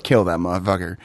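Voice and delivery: low, hushed command; understated, low-voiced menace; quiet kill order. Voicing a silent order from the film.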